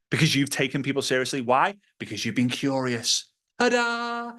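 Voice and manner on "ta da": high, bright fanfare "Ta-da!"; ta-da reveal. Real, not acted. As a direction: present it like a magic trick. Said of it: "presenting result".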